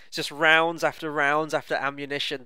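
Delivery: ordinary talk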